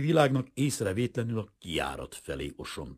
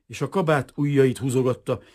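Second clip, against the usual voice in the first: usual speaking voice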